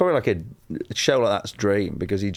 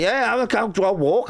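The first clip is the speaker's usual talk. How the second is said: high, eager blurting; over-eager acceptance; 12 semitones higher than usual. Retold or imitated. Comedian replaying himself grabbing the job, over-eager. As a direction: jump at the offer, pitch leaping.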